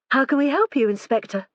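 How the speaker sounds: a gentler hostess voice; lightly voiced gentle wife. A narrator's reading. Lorraine greets the inspector.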